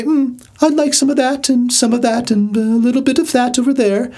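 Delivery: high, fussy buffet customer; persnickety diner. Acted. Picky guest pointing out food to the chef, high fussy voice. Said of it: "and say".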